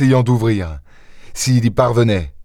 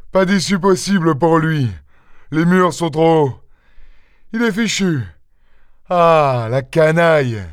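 raised-pitch triumphant jeering; gloating; 5 semitones higher than usual. A narrator's reading. Master certain the thief is cornered, gloating. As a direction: crow over the trapped man, pitched up.

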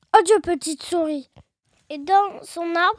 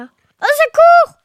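high, loud, stretched cry; cri au secours; 8 semitones higher, 9 dB louder, slower than usual. A narrator's reading. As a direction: shriek for help as a frightened fox. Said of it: "il cria". (cri aigu de panique jouée)

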